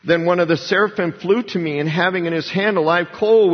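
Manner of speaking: normal talking voice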